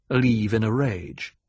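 plain reading voice

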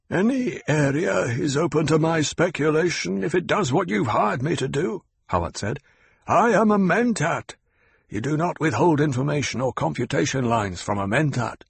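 Hawat, sharp and knowing. Acted. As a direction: lay out the facts to corner him. Hawat asserts himself.

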